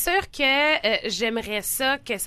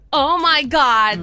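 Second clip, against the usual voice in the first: mock horror; 4 semitones higher, 10 dB louder, much slower than usual. Real, not acted. Mock horror at own confession.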